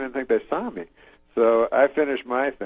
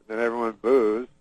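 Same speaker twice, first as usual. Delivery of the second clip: ordinary delivery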